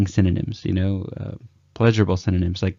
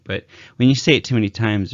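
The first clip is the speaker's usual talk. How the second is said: ordinary talk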